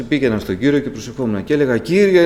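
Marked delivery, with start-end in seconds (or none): 1.8-2.3 high, loud cry of "Lord"; retold plea; pleading call. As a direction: call out to God in loneliness. Retold lonely prayer.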